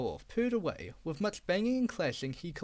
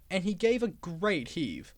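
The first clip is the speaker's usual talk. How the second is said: ordinary delivery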